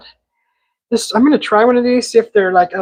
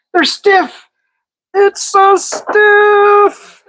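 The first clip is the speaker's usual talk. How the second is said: much higher, very slow drawn-out mock voice; mock dramatic wail; 12 semitones higher, 5 dB louder, much slower than usual. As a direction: stretch it out like a cartoon lament. Joking about stiff chips.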